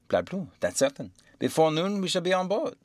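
character voice rising high at the end; self-directed muttering, climbing in pitch; muttering to himself. A narrator's reading. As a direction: echo the master's assurance doubtfully to yourself. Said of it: "muttering to himself".

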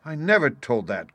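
a touch of wounded outburst; indignant cry. A narrator's reading. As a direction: lightly cry out in denial. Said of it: "cried the squire".

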